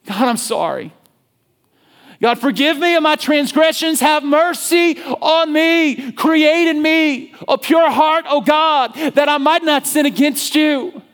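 high, loud, slow crying out for mercy; anguished prayer. Retold or imitated. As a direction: plead desperately for forgiveness. David cries out to God.

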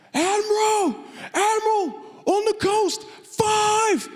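very high, loud alarm cry; lookout's shout. Acted. Lookout yelling to the admiral about five ships, high and loud. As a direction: scream it down from the crow's nest. Lookout calling from crow's nest.

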